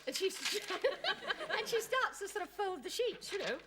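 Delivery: high, laughing through the words; real laughter carrying the line. Real, not acted. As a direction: giggle as you tell it. Laughing while telling.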